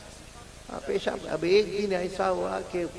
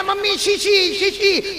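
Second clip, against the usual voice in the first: put-on child's call; 12 semitones higher, 4 dB louder, much slower than usual. Child shouting to mother before guests.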